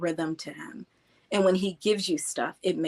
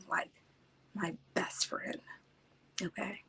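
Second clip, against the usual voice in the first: teary-eyed; 11 dB quieter than usual. Real, not acted. Remembering the grandfather as a lost best friend, teary-eyed. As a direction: voice drops away as real tears well up. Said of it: "You see, I get teary-eyed".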